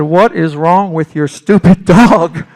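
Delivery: loud, slow, fed-up yell; retold loud blast at the girlfriend's dog; exasperated shout.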